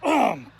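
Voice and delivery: clearing a bug from the throat; hacking cough. Real, not acted. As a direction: cough it up hard. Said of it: "it's in the back of my throat".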